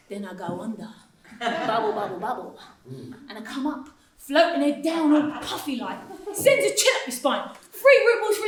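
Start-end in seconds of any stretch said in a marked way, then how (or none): 1.4-2.6 mock drowning bubbles. Imitating sinking underwater.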